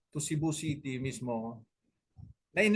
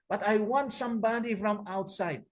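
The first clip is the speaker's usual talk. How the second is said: a raised tenor voice for the caller; high tenor voice; 7 semitones higher than usual. Retold or imitated. The pastor insisting on an outsider, high tenor. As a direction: pitch it up like a tenor, imitating the caller. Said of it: "matining yung boses niya, tenor yata eh".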